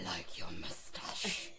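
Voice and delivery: unvoiced low whisper; creepy whisper. Creeping out the salesman, whispered. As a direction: hiss it like a stalker.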